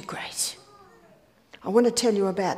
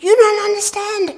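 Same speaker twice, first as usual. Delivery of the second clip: high, loud imitation of an aggrieved man; outraged protest; 11 semitones higher, 8 dB louder than usual. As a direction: act out shouting back in hurt indignation. Doug's protest acted out again.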